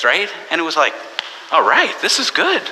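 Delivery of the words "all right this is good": high, pleased mock reaction; delighted. Preacher reacting to the boy with pleased excitement, pitched up. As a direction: perk up at a good idea.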